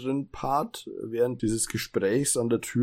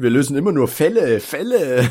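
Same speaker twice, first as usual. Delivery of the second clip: raised, loud, insistent ranting; loud insistent quote; 6 semitones higher, 7 dB louder than usual. Acted. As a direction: hammer home the word immer, outraged. Acted quote from the episode. (lauter empörter Ausruf)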